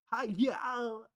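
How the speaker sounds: high, loud mock Southern twang; exaggerated Southern hello, played for laughs; southern accent. Streamer imitating the shared Antarctic accent, mock Southern twang. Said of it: "speaking my southern accent".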